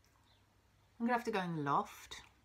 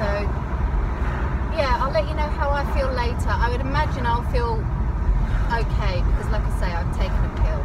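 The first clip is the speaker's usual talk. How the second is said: loud over road noise; 3 semitones higher, 13 dB louder than usual. Real, not acted. Update recorded in noisy car.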